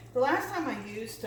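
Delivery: usual speaking voice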